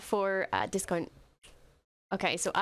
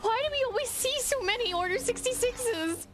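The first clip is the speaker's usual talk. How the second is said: tearful plea; 13 semitones higher than usual. Real, not acted. Distressed by Order 66 scenes.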